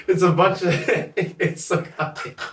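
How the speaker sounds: breaking into giggles mid-sentence; laughing. Real, not acted. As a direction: laugh through the words, high and breathy. Laughs explaining bush doof.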